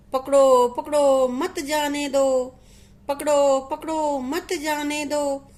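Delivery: measured loud calls with drawn-out endings; read-aloud cry of alarm; parrot's shouting. A narrator's reading. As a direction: call it out like an alarmed storybook parrot, only half shouting. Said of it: "चिल्लाने लगा".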